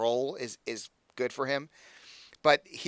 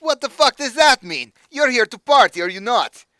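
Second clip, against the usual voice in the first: much higher and louder, strained put-on accent; accented villain voice; 11 semitones higher, 13 dB louder than usual. Patron refusing to leave the booth, loud strained accent. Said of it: "Doing this voice is gonna kill me".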